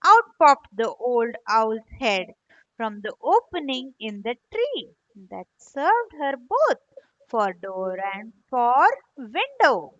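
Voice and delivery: ordinary delivery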